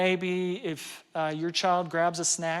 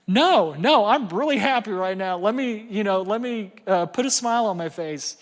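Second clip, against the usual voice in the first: eager reassurance; 3 semitones higher, 7 dB louder than usual. Retold or imitated. Dad to son who asks if he's mad, loud eager reassurance. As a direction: insist you're happy, pushing the words out. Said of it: "And I'm like".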